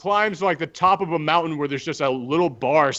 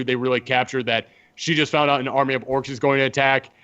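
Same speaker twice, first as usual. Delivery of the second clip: ordinary talk, nothing marked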